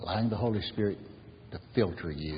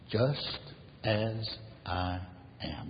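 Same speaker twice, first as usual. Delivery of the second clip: slow, spaced-out words, falling softer; 5 dB quieter, much slower than usual. Retold or imitated. Preacher repeats the hymn line to the congregation, word by word.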